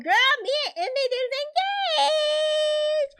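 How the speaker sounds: mock giddy squeal, very high; Keisha. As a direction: squeal the news in a put-on high voice, drawing it out. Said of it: "Keisha is so happy".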